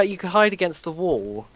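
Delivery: usual speaking voice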